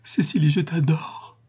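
high, whispery rapture; exalted adoration. A narrator's reading. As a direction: breathe out the adoration in ecstasy. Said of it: "avec une exaltation inexprimable".

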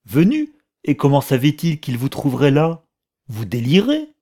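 loud, high, scornful incredulity; furious disbelieving retort, pitch jumping up; outraged disbelief. A narrator's reading. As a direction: throw the word back as a shrill, loud challenge. Heated quarrel, disbelieving retort.

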